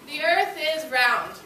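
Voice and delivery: ordinary talk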